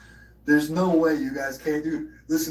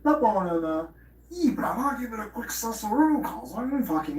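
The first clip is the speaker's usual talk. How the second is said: much higher put-on bro voice; goofy islander-style bro, pitched way up; character impression; 9 semitones higher than usual. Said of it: "impression of somebody with some fucking character".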